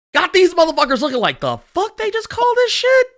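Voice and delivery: high-pitched comic disbelief; mock bewilderment. Host voicing the actors' reaction to the title, mock bewildered. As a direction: voice baffled outrage in a high pitch.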